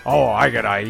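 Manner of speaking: normal talking voice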